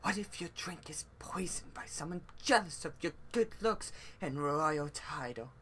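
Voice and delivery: soft, half-whispered worry; hushed warning. Acted. Character warning a drinker about poison, hushed.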